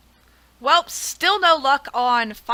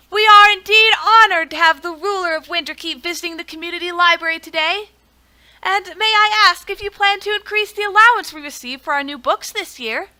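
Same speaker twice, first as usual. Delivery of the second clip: librarian; 5 semitones higher, 5 dB louder than usual. Acted. Voicing the librarian NPC.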